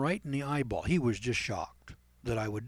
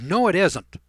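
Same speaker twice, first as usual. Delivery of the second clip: louder, firm and final; stern denial; 5 semitones higher, 10 dB louder than usual. Retold or imitated. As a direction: shut him down flatly. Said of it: "I very sternly said".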